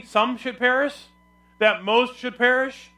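SOME and MOST high and slow, rising at "perish"; rhetorical questions. Shown as a demonstration. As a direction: ask it high and drawn out, as if testing them. Offers wrong readings as questions.

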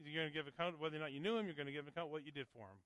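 ordinary talk, nothing marked

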